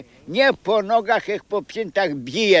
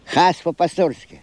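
ordinary talk

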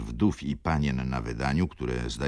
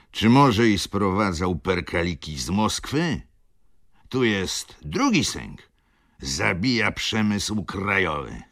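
bass voice; 5 semitones higher, 6 dB louder than usual. A narrator's reading. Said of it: "przerwał bas".

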